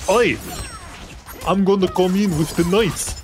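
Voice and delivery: put-on stupid Kano accent. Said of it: "the stupid accent".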